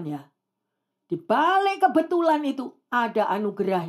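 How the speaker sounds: ordinary talk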